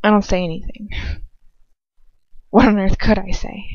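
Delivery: usual speaking voice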